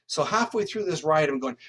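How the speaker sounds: ordinary talk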